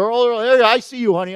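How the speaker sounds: usual speaking voice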